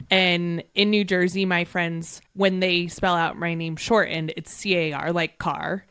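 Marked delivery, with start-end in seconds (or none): none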